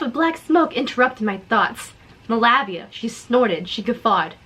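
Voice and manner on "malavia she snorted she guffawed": usual speaking voice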